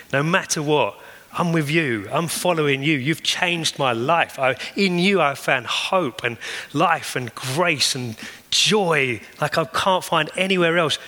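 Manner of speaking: preacher's climax, climbing in pitch; impassioned preaching. Real, not acted. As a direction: build the fervour to a peak. Preaching climax, rising in force.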